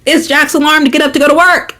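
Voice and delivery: high, emphatic complaint; high-pitched indignant realization; exasperated. Real, not acted. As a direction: blurt it out in fed-up disbelief. Exasperated realization about alarm.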